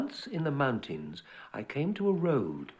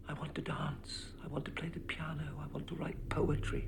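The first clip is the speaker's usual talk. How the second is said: soft breathy whisper of longing; whispering secret wishes; 3 semitones higher, 7 dB quieter than usual. Acted. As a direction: confide each wish under the breath.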